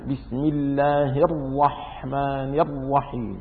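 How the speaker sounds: slow, stretched بسم الله الرحمن الرحيم, leaning on each doubled consonant; slow demonstration, holding each doubled letter of البسملة; doubled letters shown. Shown as a demonstration. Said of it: "منها ثلاث في البسملة".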